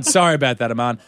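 ordinary talk

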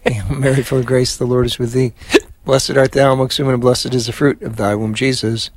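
steady devout Hail Mary; prayer recitation. Acted. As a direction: lead the prayer evenly. Leads the rosary.

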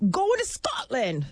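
a light, slow attempt at a Scottish accent; mock Scottish accent. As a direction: say it in a hammed-up Scottish accent, slowly. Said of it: "Is that a Scottish accent?".

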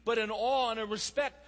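preacher pressing hard on fathers; passionate preaching. Acted. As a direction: drive the point home to the congregation. Preaching at full force.

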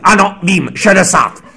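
high, loud declaration; imitating a director shouting at police; defiant shout. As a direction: bellow the answer at the officer. Director loudly answers traffic policeman.

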